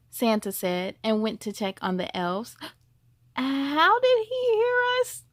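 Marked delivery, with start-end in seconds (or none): 3.4-5.2 high, startled question; surprised reindeer. A narrator's reading. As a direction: gasp out how he heard them.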